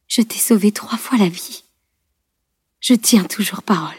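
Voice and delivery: whispered with effort, badly wounded; labored whisper. A narrator's reading. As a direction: speak faintly through the pain. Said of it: "articula-t-elle avec peine". (chuchoté avec peine)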